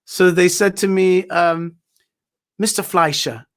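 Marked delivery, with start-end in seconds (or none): 2.6-3.5 British interviewer saying the name; lightly imitated British presenter; British accent. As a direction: address the guest in a put-on British accent. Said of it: "it's always a British accent".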